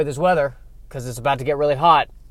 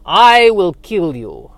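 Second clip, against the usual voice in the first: put-on Eastern European vampire accent; 8 dB louder than usual. Vampire threatening the man asking questions, Eastern European accent. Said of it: "where the accent is from".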